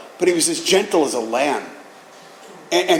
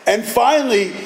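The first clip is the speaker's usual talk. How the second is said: ordinary delivery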